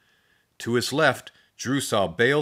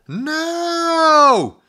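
loud, much higher cry; terrified shriek; scream; 8 dB louder than usual. A narrator's reading. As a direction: yell it in terror. Drew terrified, about to be hit.

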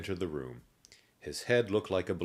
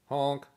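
high honk noise; 10 semitones higher, 6 dB louder than usual. A narrator's reading. Nose honk sound.

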